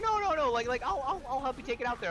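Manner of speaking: very high, loud mock insistence; eager insistence. Liquor store clerk insisting on carrying it, eager insistence. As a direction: imitate an overeager helper tripping over words.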